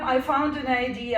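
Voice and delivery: usual speaking voice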